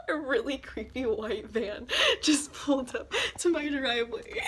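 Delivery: giggling through the words; amused and nervous, laughing through it. Real, not acted. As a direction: laugh while telling it. Laughing at creepy van.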